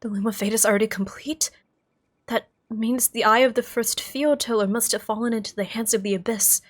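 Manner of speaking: reacting in role to news of the Loom; reading game dialogue.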